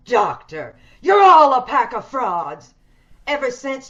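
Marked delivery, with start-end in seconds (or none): 1.0-2.7 bitter accusation. Acted. Bitter invalid attacks her doctor.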